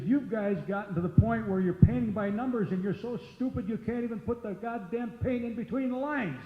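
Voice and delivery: loud, high scolding tirade; retold angry rant. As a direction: tear into the wrestlers for lazy work. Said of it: "I said".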